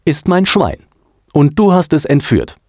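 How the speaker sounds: high, indignant accusation; accusing. A narrator's reading. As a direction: accuse hotly, pitched well up. Annoyed boy accuses the girl. (empört anklagend, mit hoher Stimme)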